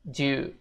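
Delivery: "Ju" said as one quick syllable; "ju" for do you. Shown as a demonstration. Teacher shows the reduced "Ju". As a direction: say "do you" as just "Ju". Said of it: "bisa baca ju".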